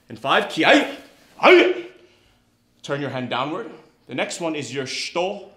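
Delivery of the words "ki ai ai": high, very loud karate yell; kiai shout. Real, not acted. Instructor ending knife hand drill, kiai shout. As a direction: build into an explosive kiai.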